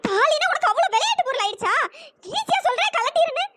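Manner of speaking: high, indignant and disbelieving; outraged. Acted. Maha to the man telling her to remove the thali, outraged. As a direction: throw his words back at him in outrage.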